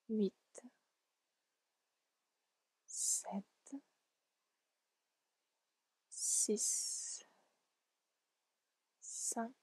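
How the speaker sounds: soft numbers fading to near whisper, long pauses between; slow, sparse, whispery counting; hushed countdown. Real, not acted. Countdown into deep relaxation.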